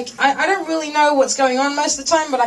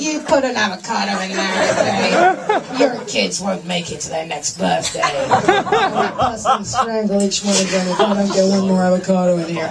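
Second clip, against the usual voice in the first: menacing threat; 5 semitones lower than usual. Retold or imitated. Comic haggling with the seller, low menace. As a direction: threaten them darkly. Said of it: "And they're like".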